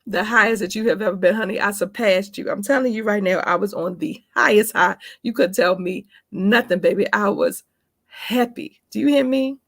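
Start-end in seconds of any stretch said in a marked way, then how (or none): none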